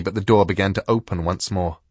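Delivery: usual speaking voice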